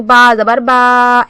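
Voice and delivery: slow "बा जबर बा" with the vowels dragged out; drawn-out demo of a dragged "बा"; stretched vowels. Shown as a demonstration. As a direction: overstretch each "बा". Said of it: "ऐसे नहीं पढ़ना है".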